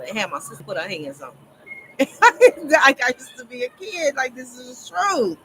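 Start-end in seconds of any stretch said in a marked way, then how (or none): 1.7-2.6 sudden high burst of laughter; real laugh. Real, not acted. Telling viewers a childhood story, bursts into high laughter. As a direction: cracks up loud and high at her own story.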